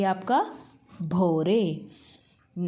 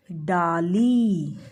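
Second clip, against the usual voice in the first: "डाली" stretched very slowly and louder; very slow dictation; 4 dB louder, much slower than usual. Shown as a demonstration. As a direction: drag out "डाली" for the kids to repeat. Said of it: "क्या है ये?". ("डाली" बहुत धीरे, खींचकर)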